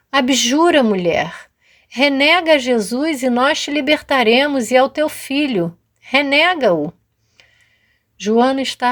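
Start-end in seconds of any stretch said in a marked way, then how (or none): 0.1-6.9 raised, higher voice pressing her to recant; a commanding Roman guard, pushing hard; stern soldier's demand. Acted. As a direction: praetorian soldier orders the mother to abjure, stern raised voice. Roman soldier demands she renounce.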